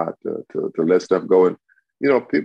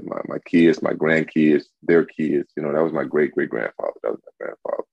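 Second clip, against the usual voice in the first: normal talking voice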